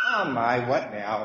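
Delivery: lightly acted groggy reply; low, put-on sigh of being woken; just-woken grumble. Jesus woken from his nap, mildly groggy and low. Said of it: "rubs his eyes and says".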